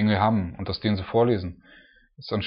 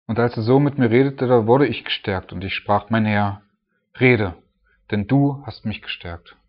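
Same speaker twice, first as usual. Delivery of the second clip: louder, solemn Bible reading; scripture reading; 5 dB louder than usual. Acted. Preacher reading Daniel's reply aloud to the church. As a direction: read it out with weight.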